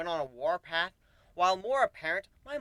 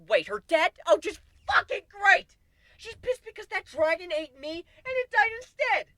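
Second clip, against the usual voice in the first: exasperated outburst; 12 semitones higher, 7 dB louder than usual. Real, not acted. Narrator ranting to friends about the dragon's daughter. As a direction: rant in disbelief at the bad luck.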